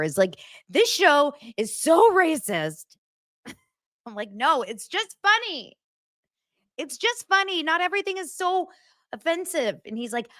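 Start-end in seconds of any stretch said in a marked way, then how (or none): 0.7-3.0 put-on higher, slow indignant teen; imitating teen son. Teen son objecting to the show, mock indignant. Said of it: "my thirteen-year-old social justice warrior". 4.3-9.8 mock exasperated mom. Mother insisting to her son it's funny, high pleading.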